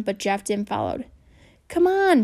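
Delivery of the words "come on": urging. A narrator's reading. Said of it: "Bradley urged".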